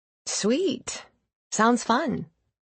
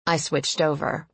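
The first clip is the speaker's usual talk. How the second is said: usual speaking voice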